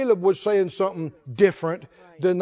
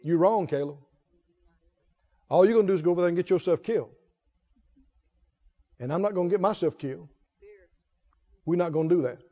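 lightly voiced spies, softer and fast; 5 semitones lower, 4 dB quieter, faster than usual. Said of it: "They say".

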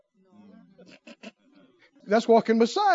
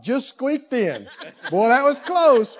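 mock relief; 4 semitones higher, 3 dB louder, slower than usual. Mock relief at heaven's gate.

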